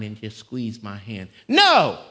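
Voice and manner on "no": sudden high shouted "No!". Real, not acted. Preacher rejecting a handshake salvation, shouting. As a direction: bark the word out hard and high.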